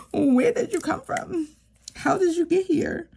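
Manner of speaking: high, incredulous mock surprise at her own savings; playful disbelief at her own bank balance; mock amazed. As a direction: act stunned, like meeting a stranger. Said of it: "I'd be like".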